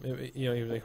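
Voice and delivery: ordinary talk, nothing marked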